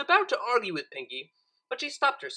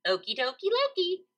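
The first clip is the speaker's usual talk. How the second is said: much higher chirpy reply; chirped; 9 semitones higher than usual. A narrator's reading. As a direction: chirp it cheerfully. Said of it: "Pinkie chirped".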